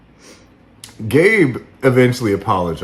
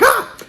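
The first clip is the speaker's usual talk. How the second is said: short, high, louder startled yelp; mock startled scream; 4 dB louder than usual. Host acting out every time they come on, mock scared yelp. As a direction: yelp as if hit by a jump scare. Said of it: "they're like a jump scare".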